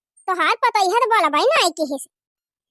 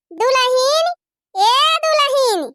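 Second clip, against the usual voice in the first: calling out; 5 semitones higher, 3 dB louder, slower than usual. Acted. Calling daughter-in-law again, louder.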